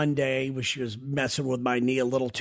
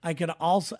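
ordinary delivery

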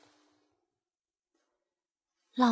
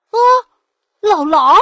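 louder startled shout of recognition; shocked cry; 5 semitones higher, 12 dB louder than usual. Acted. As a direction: blurt it out as the wolf is spotted. Piglet spots the wolf.

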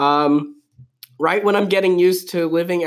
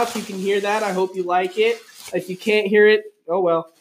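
usual speaking voice

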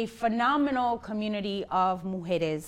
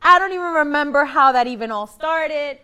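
high, laughing retold friend; laughing quote; 6 semitones higher, 7 dB louder than usual. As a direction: laugh through the friend's words. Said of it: "You were like".

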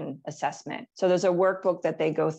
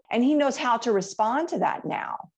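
ordinary talk

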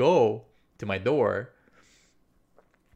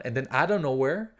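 ordinary delivery

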